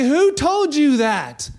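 God demanding to know who said it; searching question. Acted. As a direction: lean hard on "Who". God confronts hiding Adam.

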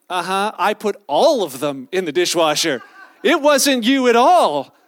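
loud, triumphant gotcha, rising in pitch; loudly bust the fib, stretching the last words; indignant dad. Retold or imitated. Dad catches his son fibbing about the dishwasher, loud indignation.

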